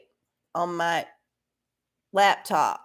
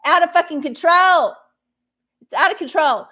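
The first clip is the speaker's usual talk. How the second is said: louder, higher angry blast; angry outburst; 6 semitones higher, 7 dB louder than usual. Real, not acted. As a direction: let the anger burst out on control. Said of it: "I'm so mad".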